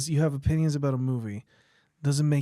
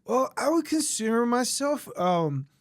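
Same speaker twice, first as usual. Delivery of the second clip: pompous; 7 semitones higher than usual. Retold or imitated. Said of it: "the way he said it".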